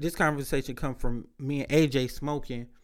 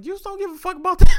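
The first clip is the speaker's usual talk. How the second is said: much higher, much louder and fast; exclaiming; 13 dB louder, faster than usual. Retold or imitated. Telling the phone store guy off, high excited exclaim. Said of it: "I was like".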